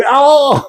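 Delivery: high mock yelp of pain; imitated pained cry. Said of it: "she made this noise".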